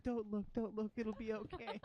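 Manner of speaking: high, anxious creature voice; ratling parent. Acted. Ratling coddling children before the ghost, high protective voice. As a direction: comfort the little ones in a high voice. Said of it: "coddling smaller giant rats like children".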